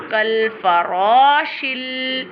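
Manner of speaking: heavy, long "ra" in "farashil", slowly chanted; thick ra. Shown as a demonstration. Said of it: "with a thicker voice like this".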